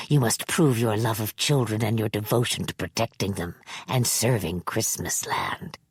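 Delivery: put-on low, whispery sinister old man; a creepy elder confiding a secret. Manx's held voice.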